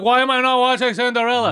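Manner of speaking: high, loud imitation of a baffled whiner; mock clueless complaint. Mocks friend's clueless complaint.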